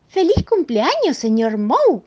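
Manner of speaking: felicitación aguda y fuerte, a ritmo normal; voz de señora alegre. Acted. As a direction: felicita con alegría chispeante. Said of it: "dijo la señora Moe". (voz alegre de señora)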